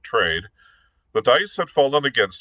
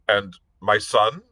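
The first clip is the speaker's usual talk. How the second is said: anxious cry; 6 semitones higher than usual. Acted. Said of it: "he cried out".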